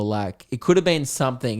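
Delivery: normal talking voice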